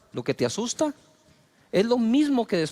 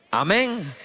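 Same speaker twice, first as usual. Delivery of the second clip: normal talking voice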